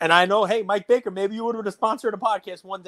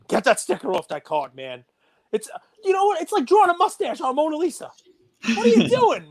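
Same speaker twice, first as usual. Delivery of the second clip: outraged disbelief; 10 semitones higher than usual. Real, not acted. Outraged at sticker on card.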